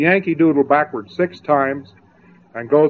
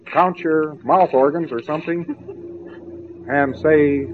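ordinary delivery

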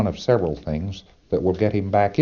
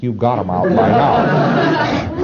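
slow amused punchline; 4 semitones higher, 7 dB louder, much slower than usual. Real, not acted. Punchline joke to audience.

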